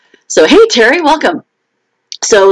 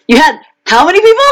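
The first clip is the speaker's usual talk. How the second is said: high, quick, laughing question; much faster than usual. Real, not acted. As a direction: say it through real laughter. Laughing over other cities' huge crowds.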